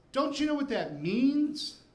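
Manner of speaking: high, slow horrified mother. Retold or imitated. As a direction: gasp it at the child in shock. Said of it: "She looks at me in horror".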